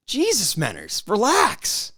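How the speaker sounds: drawn-out exasperation, then a high relax; exasperated. Real, not acted. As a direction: plead with him to knock it off. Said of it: "telling Menners to calm down".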